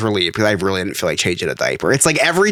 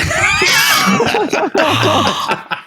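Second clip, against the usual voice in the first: high shrieking laugh. Real, not acted. Funny story punchline.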